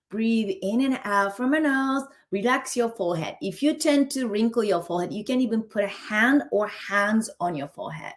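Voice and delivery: normal talking voice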